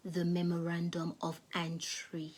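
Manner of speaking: soft unvoiced whisper; a lady whispering advice in the court. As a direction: lean in and whisper the tip. Lady's secret advice.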